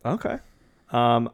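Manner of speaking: usual speaking voice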